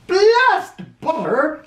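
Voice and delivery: slow, high drawn-out protest; disgusted outburst. Acted. President rejecting the butter plan, slow and high. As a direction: spit the word butter out slowly. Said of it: "said Taft".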